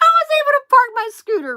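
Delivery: high, loud, fast through laughter; laughing while talking. Real, not acted. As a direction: squeal it out mid-laugh. Cracking up while telling story.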